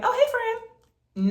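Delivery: high, slow, sugary greeting; fake friendly greeting. Mocking how you greet a loose acquaintance, falsely sweet. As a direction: sing out a fake friendly hello. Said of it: "you're like".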